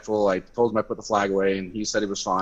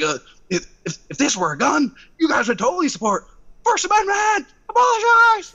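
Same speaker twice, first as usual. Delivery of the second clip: climbing from raised voice to a much higher, louder shout; rising protest shout; 5 dB louder than usual. Retold or imitated. Arguing with guards pushing him out, building to a shout. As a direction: build from arguing into a full yell. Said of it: "So I'm like".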